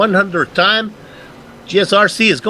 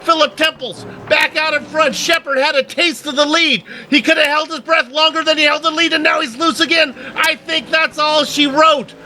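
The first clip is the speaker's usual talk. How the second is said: fast, raised race-caller voice; excited play-by-play; 10 semitones higher than usual. Acted. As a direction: call the final laps with rising excitement. Excited live race call.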